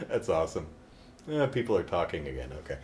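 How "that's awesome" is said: laughing. Real, not acted. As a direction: laugh it out softly. Laughing at own bit.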